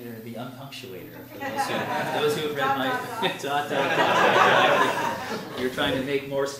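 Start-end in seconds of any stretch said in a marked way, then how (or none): 1.4-5.2 laughing out each word; self-mocking laugh. Real, not acted. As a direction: giggle through the dots. Mocking his own punctuation.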